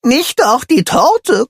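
slow, breathless high plea; gasping. A narrator's reading. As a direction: gasp it out. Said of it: "japst Pinsel".

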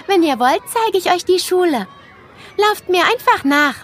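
hohe, helle Mädchenstimme, einladend; begrüß die Kleinen wie ein stolzes Schulkind; freundliches Schulmädchen. A narrator's reading. Mia stellt sich vor. (Mia begrüßt Nele am Schultor: hoch und freundlich)

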